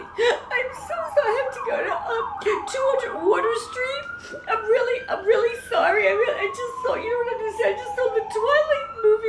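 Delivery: very high hammed-up blubbering; squealed, barely coherent tearful gushing; hysterical crying. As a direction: wail apologies to a stranger through mock sobs. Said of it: "I'm crying".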